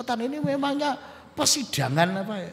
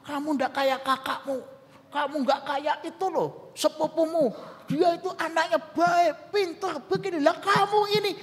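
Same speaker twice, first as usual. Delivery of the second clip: comparing parent; 8 semitones higher than usual. Acted. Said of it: "suka membanding-bandingkan".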